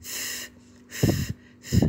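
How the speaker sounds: drawn-out voiceless "ف", just air; three separate breathy f sounds; isolated F sound. Shown as a demonstration. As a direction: sound out "ف" as a long fff. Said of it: "زي صوت حرف الاف بالظبط".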